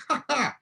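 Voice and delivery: high playful ha ha; mock laugh. Confident agent enjoying the sport, high mock laugh. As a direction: toss off a gleeful mock laugh. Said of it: "You're like".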